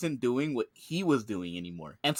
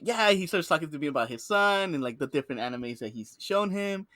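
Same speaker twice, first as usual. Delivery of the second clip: normal talking voice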